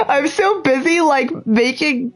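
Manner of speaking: can barely get the words out for laughing. Real, not acted. Gamer cracking up at his own Watson voice, laughing.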